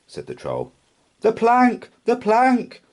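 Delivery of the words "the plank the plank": high, loud chanting yell; gleeful yell. A narrator's reading. Pirates calling for the Troll to walk the plank, high yell. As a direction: yell it twice, eager for the plank. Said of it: "yelled Ben Buckle and Percy Patch".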